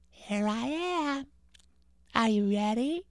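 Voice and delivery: ghost voice. Acted.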